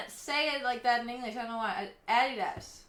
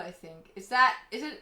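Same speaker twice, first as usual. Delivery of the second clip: ordinary talk, nothing marked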